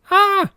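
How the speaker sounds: very high, loud startled scream; piercing shriek. A narrator's reading. Startled old woman leaping from the corpse, piercing shriek. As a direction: shriek in fright. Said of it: "gave a piercing shriek".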